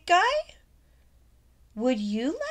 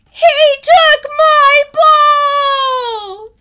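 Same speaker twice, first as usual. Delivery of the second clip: much higher, louder and slow; outraged wail; 13 dB louder, much slower than usual. Acted. Little one wails to Gerald about the stolen ball. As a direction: drag it out in a loud wail.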